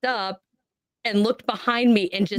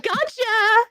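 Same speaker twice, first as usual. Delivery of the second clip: put-on high, teasing child's gotcha; taunting kid; 13 semitones higher than usual. Unseen child taunting the mom, higher and teasing. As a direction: crow it like a prankster kid.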